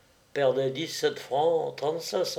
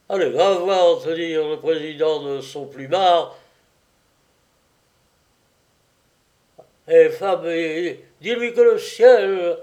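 mock-grand archangel orders, rising louder; solemn command; 3 semitones higher, 5 dB louder than usual. A narrator's reading. Self-styled archangel sends Louisette to the president, solemnly. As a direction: command it like a heavenly herald. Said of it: "plus solennelle encore".

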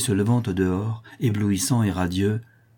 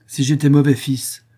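loud, higher, abrupt retort; brusque indignation; 6 semitones higher, 6 dB louder than usual. A narrator's reading. Son revolted by mother's harshness, brusque retort. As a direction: answer back, stung by injustice. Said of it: "répondit brusquement Martial, révolté".

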